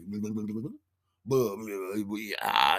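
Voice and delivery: tongue-tied burbling noises; flustered babble. Retold or imitated. Dancer offered an audition, flustered babble. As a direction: sputter nonsense syllables before finding words.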